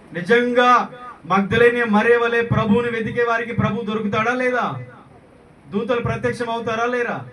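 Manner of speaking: high, ringing challenge to the congregation; impassioned preaching. Real, not acted. Preacher challenging congregation about seeking the Lord, impassioned preaching.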